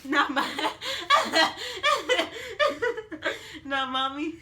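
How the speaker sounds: loud laughter breaking into the words; laughing. Real, not acted. Mother amused by her little child, laughing. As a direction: repeat it helplessly through big laughs.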